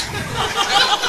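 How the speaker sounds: sudden high giggle; a bright laugh bursting out after the joke. Real, not acted. Laughing at his own mother-in-law joke, high giggle.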